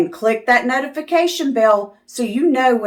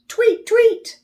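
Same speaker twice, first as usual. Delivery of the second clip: tiny chirp, much higher; bird chirp; 14 semitones higher than usual. Pretend. Bird sound for children.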